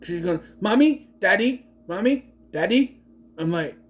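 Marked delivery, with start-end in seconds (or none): 0.6-3.0 put-on high, loud whiny toddler calling for her parents; mocking the ten-year-old who sounds like a toddler; little kid voice. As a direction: mimic a four-year-old calling mommy and daddy. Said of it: "And she sounds four".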